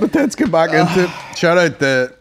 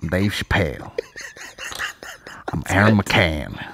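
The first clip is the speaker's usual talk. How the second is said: Dave Chappelle impression; 8 semitones lower, 4 dB quieter than usual. Comic at home with mother, told in deep impression. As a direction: set up the bit low and unhurried. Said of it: "trying to get the deepness in here".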